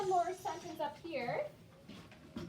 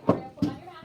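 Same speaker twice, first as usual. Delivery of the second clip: fast, much louder call across the room; 3 semitones higher, 12 dB louder, faster than usual. Real, not acted. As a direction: snap it out fast to get her attention. Teacher calls out to student.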